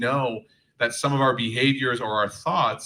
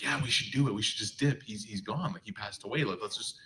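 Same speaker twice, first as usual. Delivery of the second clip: conspiratorial hushed aside, much softer; hushed murmuring; 8 dB quieter than usual. Retold or imitated. Tribe members murmuring about leaving, hushed. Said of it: "some internal murmuring".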